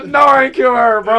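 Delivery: very high panicked shout; retold panic. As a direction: yell it in mock alarm, pitch way up. Retells panic she had died.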